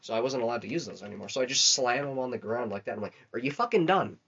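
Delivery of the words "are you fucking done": put-on louder, raised bark of a bossy older sibling; mock stern demand. Sibling pinning his little brother on the ground, stern mock demand. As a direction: lay down the law on him.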